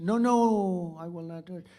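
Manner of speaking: hammed-up French refusal, then dropping back; Macron French accent. The French president refusing again in a mock French accent. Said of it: "Beautiful accent".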